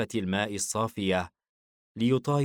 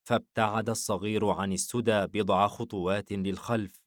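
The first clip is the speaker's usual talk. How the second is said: plain reading voice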